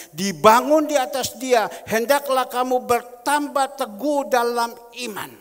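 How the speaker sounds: very loud, high preaching proclamation; declaiming scripture. Real, not acted. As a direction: thunder the verse out to the congregation. (menyerukan ayat dengan sangat lantang)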